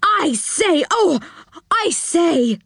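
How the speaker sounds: excited, high-pitched exclamations. Acted. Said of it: "suddenly got very excited".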